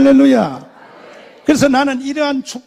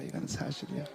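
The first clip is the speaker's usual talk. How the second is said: hushed aside; 9 semitones lower, 20 dB quieter than usual. Real, not acted. Preacher's hushed repeat.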